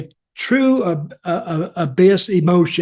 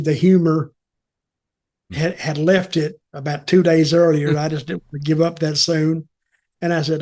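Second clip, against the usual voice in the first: normal talking voice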